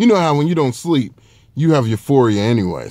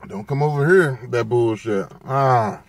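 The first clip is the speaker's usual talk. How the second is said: ordinary talk, nothing marked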